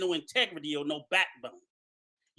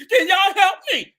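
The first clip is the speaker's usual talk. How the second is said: high, loud mocking plea for help; 8 semitones higher, 6 dB louder than usual. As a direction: ape a helpless begger. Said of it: "running to none of you".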